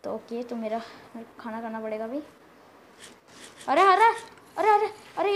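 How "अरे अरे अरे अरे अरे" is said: loud, high, rapid-fire yelps; startled alarm. Real, not acted. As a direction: blurt it out in sudden shock. Startled by a sudden game threat. (चौंककर ज़ोर से)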